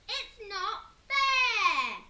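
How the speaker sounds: high, very loud, slow crying scream; Henry screaming it's unfair. A narrator's reading. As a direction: scream it through tears. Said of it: "screamed Horrid Henry".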